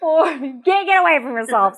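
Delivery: high, breaking into laughter; helpless giggle while talking; laughing. Real, not acted. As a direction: laugh through the words. Laughing with partner at own point.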